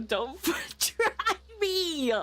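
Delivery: high, slow, laughing "try me"; giggling dare; laughing mock threat. Real, not acted. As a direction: tease through laughter.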